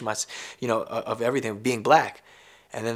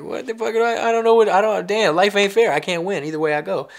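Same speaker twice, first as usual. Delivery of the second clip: exasperated outburst; 7 semitones higher, 5 dB louder than usual. Retold or imitated. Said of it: "I'm like".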